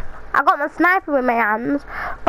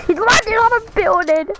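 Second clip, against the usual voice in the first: frustrated cry; 7 semitones higher than usual. Real, not acted. Player sees the enemy land, frustrated high cry. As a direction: yell in dismay.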